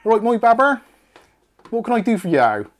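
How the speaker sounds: loud, high, hammed-up Bristolian accent. Host imagining a Bristolian Siri, hammed-up Bristolian accent. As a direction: put on a broad Bristolian voice assistant. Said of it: "ugly Bristolian Siri would be great".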